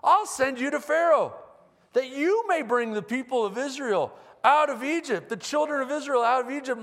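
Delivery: God cheerfully announcing the plan; upbeat tone. Retold or imitated. Preacher voicing God's call to Moses, upbeat. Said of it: "God said that in an upbeat tone".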